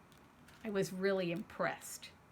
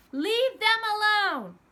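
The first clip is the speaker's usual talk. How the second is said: loud, much higher, slow yell; desperate yell; 10 semitones higher, 9 dB louder than usual. Acted. A scared kid yelling at the bully to leave the boys alone. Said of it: "yelled at the man".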